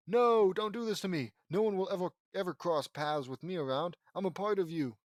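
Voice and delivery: dramatic villain refusing defeat; desperate protest. Acted. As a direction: protest frantically, easing off as it goes. Villain protests as he fades away.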